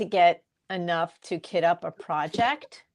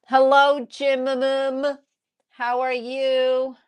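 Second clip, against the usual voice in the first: greeting a pet; 5 semitones higher, 5 dB louder than usual. Real, not acted. Greeting the cat warmly.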